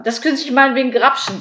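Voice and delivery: loud, high, quick urging; eager offer. A narrator's reading. Käthe offers the burglar a doily, eager. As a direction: blurt the offer out briskly. Käthe urges thief to grab it.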